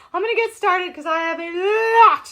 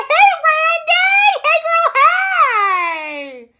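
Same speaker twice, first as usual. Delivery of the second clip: higher, louder slow shouted hello; excited shout greeting; 11 semitones higher, 8 dB louder, much slower than usual. Real, not acted. Host greeting Randy in the chat, loud and high.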